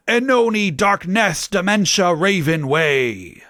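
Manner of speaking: grand, high-pitched theatrical declaration; angry Dumbledore. A narrator's reading. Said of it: "Dumbledore said angrily".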